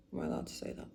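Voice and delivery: a quick, unvoiced aside as if to the camera; whispered aside. Real, not acted. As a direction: whisper it like a guilty confession. Cheeky aside about a word.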